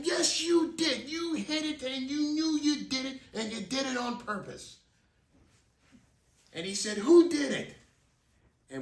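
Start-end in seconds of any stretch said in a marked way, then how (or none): 0.0-4.8 accusing neighbor. Retold or imitated. Rick accusing the kids. 7.0-7.8 high, loud who did it; angry neighbor yelling; demanding shout. Retold or imitated. Rick demanding the culprit.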